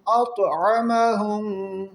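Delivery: slow, drawn-out tajweed chant; تلاوت کا انداز; Quran recitation. Shown as a demonstration. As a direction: stretch the long vowels like a reciter. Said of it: "عین کی بھی sound".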